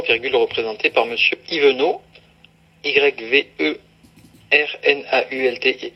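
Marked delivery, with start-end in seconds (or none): 2.8-5.9 each letter spelled out separately; spelling out letters. Shown as a demonstration. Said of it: "c'est Yvernault".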